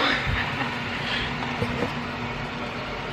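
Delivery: silent wheezing laugh; breathy laugh. Real, not acted. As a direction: laugh under your breath. Laughing at the drawer sound.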